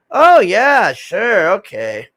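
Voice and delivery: sarcastic. Retold or imitated. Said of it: "And like".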